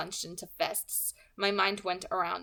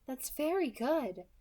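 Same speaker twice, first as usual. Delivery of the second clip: light, higher, smooth villain voice; pleasant soft alto; 6 semitones higher, slower than usual. Acted. Kidnapper calmly threatening the girl on the phone, pleasant and light. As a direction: menace wrapped in a pleasant voice. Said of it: "she congratulated".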